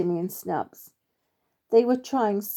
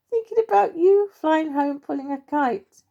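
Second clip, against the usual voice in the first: giggly, higher and louder, squirrel voice; laughing; 8 semitones higher, 5 dB louder than usual. Acted. Young squirrel tells the Major, giggling out loud. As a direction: squeeze the words out through giggles. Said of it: "cannot get his words out from laughing".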